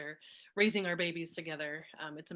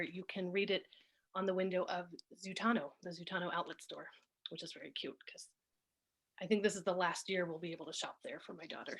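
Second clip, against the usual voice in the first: normal talking voice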